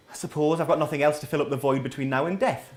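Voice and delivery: grudging, low, resigned reply; begrudging. Retold or imitated. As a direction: sigh it out like it's a chore. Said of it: "You'd begrudgingly go".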